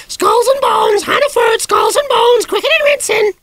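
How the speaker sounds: shrieking parrot squawk, very high and loud; parrot screech. A narrator's reading. The parrot screeching at the piano, a shrill squawk. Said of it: "a terrible screech intruded".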